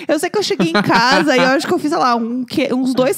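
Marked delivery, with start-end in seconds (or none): none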